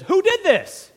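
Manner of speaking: high, loud outraged king; king's furious demand; furious shout. Acted. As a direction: bellow it as the enraged king. Enraged king shouts in retelling.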